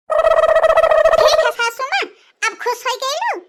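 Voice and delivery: drawn-out, high, loud sarcastic yell; sarcastic shout. Acted. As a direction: spit it at the mother-in-law slowly. Angry bride quits in-laws' home.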